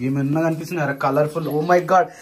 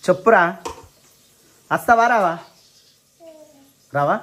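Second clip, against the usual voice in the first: loud demanding; 2 semitones higher, 5 dB louder than usual. Real, not acted. Demanding answer from toddler.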